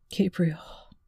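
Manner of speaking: whispered through tears; hushed, tearful plea. A narrator's reading. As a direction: barely get the words out, crying. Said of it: "breaking into tears instead".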